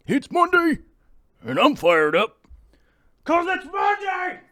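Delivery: very high fired-up shout; over-the-top high-pitched holler; excited yelling. Real, not acted. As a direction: yell it like you're bursting with hype. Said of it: "Why am I yelling?".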